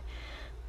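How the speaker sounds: breathy queen's sigh; soft sigh. A narrator's reading. Queen sighing about her own good taste, a weary sigh. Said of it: "the queen sighed".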